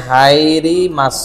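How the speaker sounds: "হাইরি মাছ" very slow, vowels stretched; drawn-out sounding out. Shown as a demonstration. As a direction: sound it out slowly while typing. Sounds out word while typing a search.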